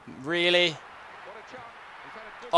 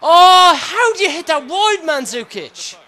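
high, loud incredulous complaint; real frustrated outburst easing off; exasperated yell; 12 semitones higher, 10 dB louder than usual. Real, not acted. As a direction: berate the striker for missing. Player misses.

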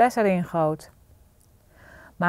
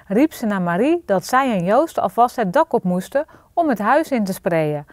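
plain reading voice

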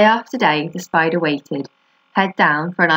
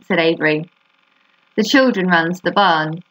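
plain reading voice

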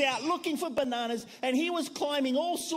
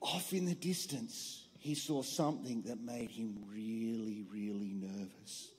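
low, soft, slow storyteller suspense; low hushed tale-telling; 12 semitones lower, 8 dB quieter than usual. A narrator's reading. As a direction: drop the voice to build dread. Suspense narration.

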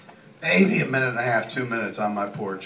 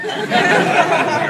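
laughing; 10 dB louder, much slower than usual. Real, not acted. Cracking up mid story.

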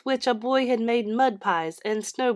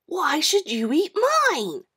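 put-on reasonable boy; 8 semitones higher, 3 dB louder than usual. John argues calmly.

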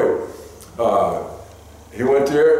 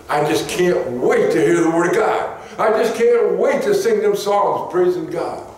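high, eager churchgoer voice; eager longing; 9 semitones higher than usual. Pretend. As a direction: sound hungry for the word. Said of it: "come here like".

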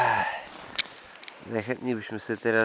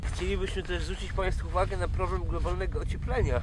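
raised, pompous announcement voice; mock formal appeal; 8 semitones higher than usual. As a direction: address the viewers like a solemn public appeal. Mock public service appeal.

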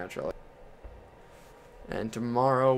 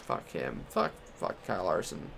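bitter grumble; 7 semitones lower, 6 dB quieter than usual. Real, not acted. Bitter muttering after race.

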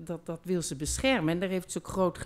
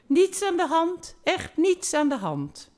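much higher, breezy dismissive reassurance; a lover waving it all away, higher and louder; airy soothing; 9 semitones higher, 4 dB louder than usual. Acted. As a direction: brush the worry off lightly. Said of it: "zich sussend".

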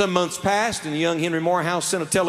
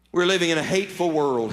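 ordinary delivery

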